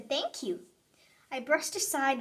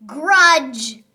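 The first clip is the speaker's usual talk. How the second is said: louder punched single word; emphatic grudge; loud declaration; 13 dB louder than usual. Acted. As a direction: slam the word down.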